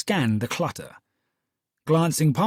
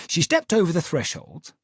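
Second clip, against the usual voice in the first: usual speaking voice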